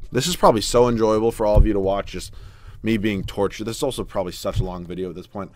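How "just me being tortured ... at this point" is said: usual speaking voice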